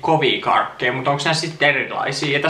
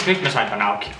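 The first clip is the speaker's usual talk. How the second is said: normal talking voice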